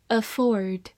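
ordinary talk

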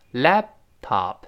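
"laptop" slowly, stopped on an unreleased p before top; pause after lap. Shown as a demonstration. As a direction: close the lips on "lap", wait, then say "top". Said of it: "停一下". ("laptop"中lap失去爆破，停一下再读top)

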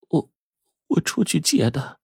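high, soft, hesitant wife's voice; faltering, evasive. A narrator's reading. Wife hides where the money came from, faltering. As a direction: stammer out a small, evasive answer. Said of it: "妻子支吾着说".

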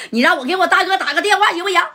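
high, fast, laughing plea; frightened thug begging to phone his big brother; pleading. Acted. Hubao begs to call his boss.

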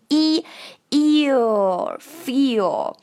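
"feel" drawn out slowly with a long ee; long vowel demo. Shown as a demonstration. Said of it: "这里的元音是一个长元音".

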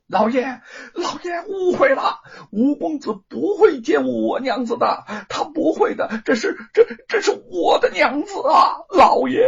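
high, loud, sobbing plea; desperate husband begging for his wife; heartrending crying plea. A narrator's reading. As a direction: beg the master through tears at full voice. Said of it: "贼儿撕心裂肺地喊起来".

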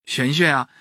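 ordinary delivery

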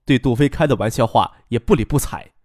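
ordinary delivery, nothing marked

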